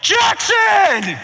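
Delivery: loud, high yell of a name; sudden high shout across the car; dad's yell. Retold or imitated. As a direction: call out the name like a dad on a ride. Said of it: "my dad would yell".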